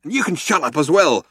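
snapped. A narrator's reading. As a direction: snap it at the guard. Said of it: "snapped Ford".

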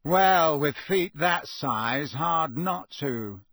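slow, sneering drawl; Malfoy mocking Ron from the trees. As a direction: drawl it out with contempt. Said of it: "said a drawling voice".

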